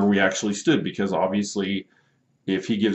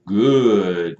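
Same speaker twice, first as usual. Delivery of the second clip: "Good" stretched long and louder; drawn-out good; 5 dB louder than usual. Retold or imitated. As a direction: hold the vowel in "Good", as if spelt with four O's. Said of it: "with four O's".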